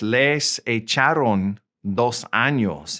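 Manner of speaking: slow, deliberate Spanish; slow model sentence. Shown as a demonstration. Teacher models the court sentence for learners, slowly. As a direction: draw out "Les echaron dos años" with small breaks.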